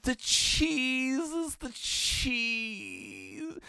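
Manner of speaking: very high, very slow dreamy voice; dazed hallucinating lad. Retold or imitated. Hallucinating classmate murmuring to his friend before fainting, very high and slow. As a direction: drift off as if lost in a trance. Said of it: "And he just goes".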